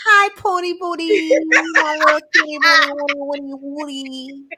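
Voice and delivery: mock lovesick baby talk to a partner. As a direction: coo it high and silly. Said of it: "He gonna be like".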